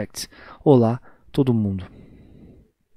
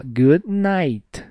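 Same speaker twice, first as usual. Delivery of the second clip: slow model; 2 semitones higher than usual. Shown as a demonstration. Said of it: "Esse good night, ó".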